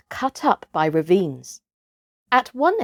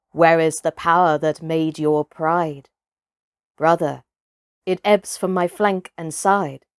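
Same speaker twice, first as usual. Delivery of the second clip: poem recital; 3 semitones lower than usual. Acted. Narrator reciting the chapter's opening verse, measured and solemn. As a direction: recite it like an old ballad.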